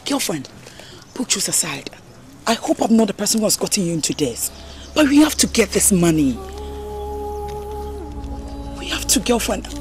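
low, softened voice; hushed confiding. Acted. Friend confiding about raising the money, hushed. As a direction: speak low and privately to a friend.

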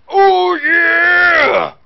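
loud gravelly wrestler growl; Randy Savage. Retold or imitated. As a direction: belt it like a wrestling promo. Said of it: "Freaking Randy Savage in this joint".

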